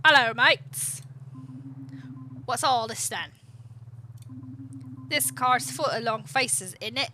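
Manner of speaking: loud, jaunty put-on British accent. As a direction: greet them like a hammed-up Brit. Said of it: "you have to do a British accent".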